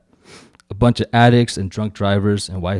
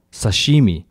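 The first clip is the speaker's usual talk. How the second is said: ordinary delivery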